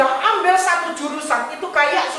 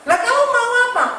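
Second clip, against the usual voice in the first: higher, quick, baffled question; father giving up on guessing, higher and baffled; exasperated father; 10 semitones higher, 3 dB louder, faster than usual. Retold or imitated. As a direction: throw up your hands at the child.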